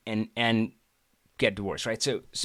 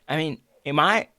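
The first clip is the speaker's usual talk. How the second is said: usual speaking voice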